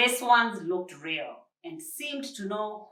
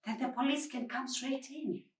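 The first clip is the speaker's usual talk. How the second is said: softer, hushed plea to Josephine's friend; whispered; 5 semitones higher, 6 dB quieter than usual. A narrator's reading. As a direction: keep it hushed so nobody upstairs hears. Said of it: "whispered Josephine".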